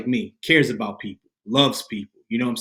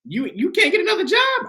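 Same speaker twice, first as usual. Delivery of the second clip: high, louder mock-mom question; incredulous mom; 5 dB louder than usual. Son imitating mom on his lost job, high and incredulous. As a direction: imitate mom's high-pitched disbelief.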